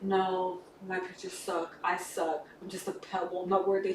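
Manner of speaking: low, soft downcast quote; mock self-deprecation. Character putting himself down to the one who likes him, dejected. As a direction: lightly act out feeling worthless.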